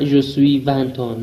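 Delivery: je suis vingt ans drawn out slowly as the wrong version; wrong form, slowly. Shown as a demonstration. Teacher shows learners the wrong way, slowly. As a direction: stretch out the mistake slowly so it's clear.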